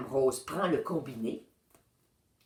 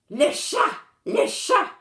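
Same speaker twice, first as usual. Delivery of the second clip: put-on high, loud kid's yell; excited shout; 13 semitones higher, 7 dB louder than usual. A narrator's reading. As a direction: yell it out like a thrilled brat. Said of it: "crie Duduche".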